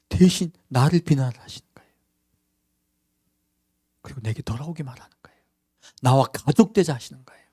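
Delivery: hushed, intimate whisper; whispered tenderness; tender whisper. Real, not acted. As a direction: whisper it as a secret of love. Tender climax of sermon.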